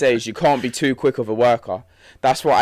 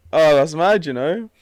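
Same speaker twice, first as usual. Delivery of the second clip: ordinary delivery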